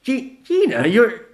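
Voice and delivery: high loud stuttered start; stammering shock. Acted. Vanilla startled at seeing Gina, stammering. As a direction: stumble over the name. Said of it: "Vanilla had found his voice".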